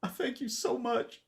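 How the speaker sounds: a hint of sobbing through the thanks; weeping thanks. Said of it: "weeps openly in front of you".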